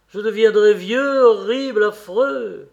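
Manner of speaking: plus fort et plus haut, désespéré; cri de désespoir. Acted. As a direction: lancer ces trois mots comme un cri d'angoisse. Dorian désespéré devant son portrait. (Dorian crie son désespoir devant son portrait)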